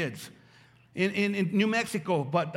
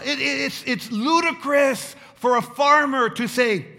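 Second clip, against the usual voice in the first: preaching shout; 5 semitones higher, 6 dB louder than usual. Real, not acted. Preacher stressing an absurdity.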